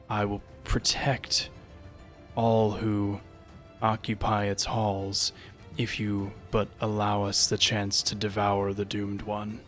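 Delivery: low, slow echoing voice; a haunting voice from the dark; unearthly voice. Acted. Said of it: "an echoing voice from down the hall".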